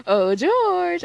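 high, loud drawn-out taunting call; imitated playful holler; Dream calling teasingly. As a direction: call out like a chaser after his prey. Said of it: "whenever he's chasing him, he goes".

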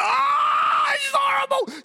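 very high, loud mock scream; hammed-up agony, screeched; over-the-top labour screaming. [name] overacting labour pains.

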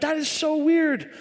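high, slow puzzled voice; mock bewilderment. Preacher recalls spotting the knife, mock bewildered. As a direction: play up the puzzlement, pitched high. Acting out his puzzled thought.

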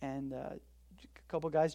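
normal talking voice

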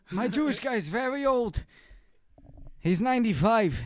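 hammed-up old man accent. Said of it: "That's a very good old man accent".

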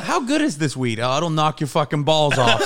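ordinary talk

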